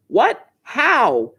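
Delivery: mild mock yell. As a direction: imitate a mother calling to another room. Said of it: "my wife yells back".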